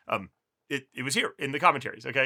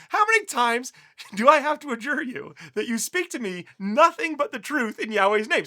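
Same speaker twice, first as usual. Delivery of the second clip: higher, louder exasperated demand; exasperated king; 10 semitones higher, 7 dB louder than usual. Retold or imitated. King rebukes the prophet Micaiah, louder and exasperated. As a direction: scold him like a fed-up king.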